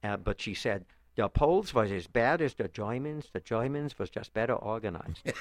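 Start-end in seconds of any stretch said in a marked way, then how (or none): 1.2-5.3 put-on louder, slow Yiddish-accented verdict, was as vas, Germans as Shoimens; mother's Yiddish accent. Son quoting his mother's line about Poles and Germans, Yiddish accent. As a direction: quote it in her thick Yiddish accent. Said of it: "my mother had this one line".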